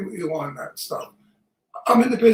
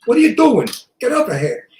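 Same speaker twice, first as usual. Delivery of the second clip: louder Brooklyn tough-guy demo; Brooklyn accent; 4 semitones higher, 5 dB louder than usual. Shown as a demonstration. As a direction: coach it in Brooklynese, R dropped from "here". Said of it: "teach me how to speak like you".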